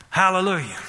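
ordinary talk

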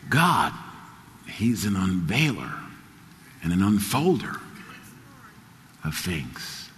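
hushed devotion, trailing off; hushed reverent litany. Acted. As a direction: draw the room in quietly. Soft litany.

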